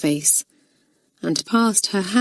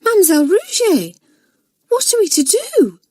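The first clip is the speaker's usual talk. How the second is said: put-on alarmed girl; 13 semitones higher, 4 dB louder than usual. Nora feigning alarm.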